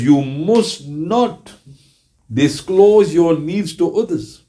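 slow, deliberate hadith quote; solemn recitation. Acted. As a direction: lay each word down slowly, with reverence. Repeating the hadith.

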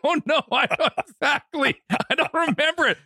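high-pitched protest. Real, not acted. Podcaster crowing that co-host can't remember either, high laughing protest.

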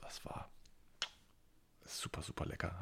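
breathy, secretive whisper. Real, not acted. Schwärmt vom Geruch des Zeugs. (geflüstert)

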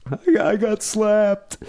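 high, slow imitation of bawling; mock crying. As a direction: wail it like a tearful girlfriend, hammed up. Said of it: "sitting at the bar bawling, like, crying".

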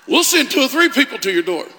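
loud, high rallying preach; preaching at full force. Acted. Pastor urging lonely folks they will be helped, loud and high. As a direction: lift the room with every line.

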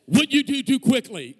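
loud, high proclamation; booming voice of scripture. Retold or imitated. Pastor acting the Bible's verdict, booming out. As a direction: boom it out like a verse from heaven.

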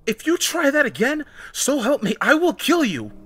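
high, loud, furious threat; enraged, high-pitched warning; growled threat. A narrator's reading. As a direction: threaten them in a rage. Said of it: "she growled".

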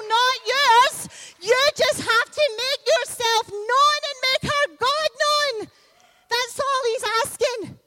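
rally the congregation, building each line; preacher at full force, urging the people to reveal themselves; full-force preaching. Acted.

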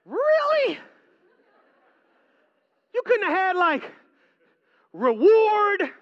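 high, loud incredulous whine; mock complaint. Audience member griping at pastor Troy, high mocking disbelief. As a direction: heckle the preacher in disbelief.

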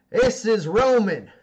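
slow, loud and high, announcing to a crowd; boss addressing the crew. Acted. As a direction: present him to the whole cavern. Tusk addresses the whole cavern.

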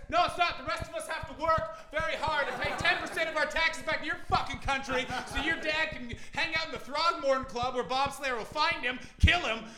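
loud, high ranting dad. Acted. Comic ranting at the crowd, like his dad, loud and high. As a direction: rant at them like an angry father. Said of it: "Yeah, I'm becoming my dad".

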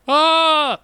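short high yelp of alarm, acted out; hammed-up yelp of dread; mock panicked yelp. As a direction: yelp like a kid told to face the dark basement. Retold panic at dad's request.

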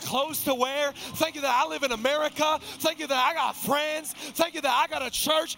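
loud, high fervent preaching. Real, not acted. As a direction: preach it out with fire. Preaching at full force.

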